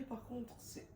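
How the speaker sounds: hushed recovery-room whisper. Real, not acted. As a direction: keep it to a whisper.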